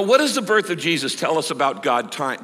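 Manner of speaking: ordinary talk, nothing marked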